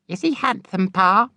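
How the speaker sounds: put-on high, coy girlish voice; coy daughter. A narrator's reading. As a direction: tease papa about the new boy. Younger daughter asks father.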